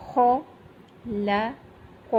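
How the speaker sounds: خلق with thick kh and heavy qaf; heavy kha. Shown as a demonstration. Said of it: "خو جو ہے یہ موٹے حروف میں سے ہے".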